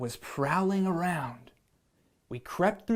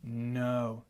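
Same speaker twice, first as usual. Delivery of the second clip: lion voice; 5 semitones lower, 6 dB quieter than usual. Acted. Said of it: "said the lion".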